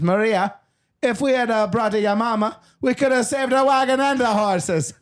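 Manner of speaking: put-on high Caribbean-tinged accent on the punchline; comic dialect shift, high and sing-song; switched dialect. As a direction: slide into a lilting Jamaican-ish accent. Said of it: "I switched into a different dialect at the end".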